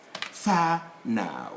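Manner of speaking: FA NOW spoken as two separate syllables. Shown as a demonstration. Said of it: "That's a syllable".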